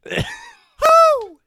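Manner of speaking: very high, loud whoops. Acted. Disguised spy fighting henchmen, falsetto pop-star whoops. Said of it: "is that [name]?".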